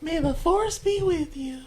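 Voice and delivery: high, slow, squeezed Yoda voice; a high, slow Yoda sign-off. Pretend. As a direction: deliver it as a high, slow, squeezed little Jedi master.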